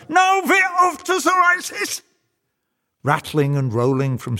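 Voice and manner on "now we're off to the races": very high, loud whoop; devil's triumphant cry. A narrator's reading. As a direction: cry out in wild glee, pitched right up. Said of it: "the devil cries out".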